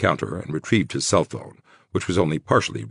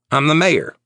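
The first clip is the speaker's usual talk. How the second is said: emphatic boast; 11 semitones higher, 6 dB louder than usual. Acted. Terry asserts authority.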